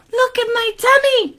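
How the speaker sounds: very high-pitched lady voice; distressed lady. Acted. Lady showing boy her tummy, high and distressed. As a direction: squeal it in mock distress. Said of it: "said the lady".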